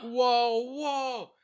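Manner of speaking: sudden excited whoa. Real, not acted. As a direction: cut in, startled and keen. Excited interruption.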